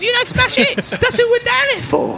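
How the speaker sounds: very high squeaky goblin snarl; small goblin voice. Acted. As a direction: squeak it out like an angry little goblin. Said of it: "He goes".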